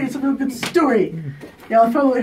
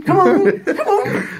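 high, louder laughing come ons; impatient come on; impatient urging; 10 semitones higher, 4 dB louder, slower than usual. Real, not acted. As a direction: push them to hurry, laughing.

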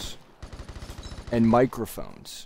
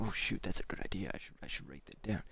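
hushed, voiceless aside to oneself; whispered thought; 6 semitones lower, 17 dB quieter than usual. Retold or imitated. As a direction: whisper the idea as if thinking it.

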